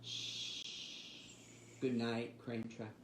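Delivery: normal talking voice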